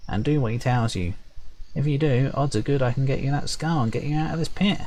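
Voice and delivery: high, quick sprite voice; perky high sprite. Acted. Sprite promising to get the skull, perky and high. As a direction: chirp it brightly, high up. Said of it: "perky, high-pitched voice".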